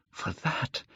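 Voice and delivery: breathless whisper. A narrator's reading. Dorian whispering his wish before crying it out. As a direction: hush it out. Repeated phrase, whispered take.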